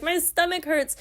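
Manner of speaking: high-pitched pretend whimper; hammed-up little sob story; mock crying. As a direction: lightly imitate a tearful girl complaining. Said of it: "she's, like, crying".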